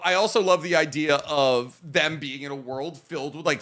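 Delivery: usual speaking voice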